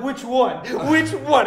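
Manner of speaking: loud, high, eager questioning; mock gawking crowd voice; imitating excited onlookers. Voicing curious women looking around.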